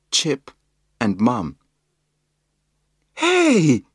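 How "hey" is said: high, loud protesting call. A narrator's reading. Mum calls out at the wet dog, loud high call. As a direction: call out as the water sprays. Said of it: "called Mum".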